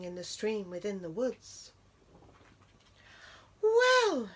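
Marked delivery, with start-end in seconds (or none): 3.6-4.3 high, bright little squeak of delight; delighted mouse. A narrator's reading. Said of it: "said Mouse, delighted".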